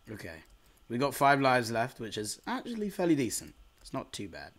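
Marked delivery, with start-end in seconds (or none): none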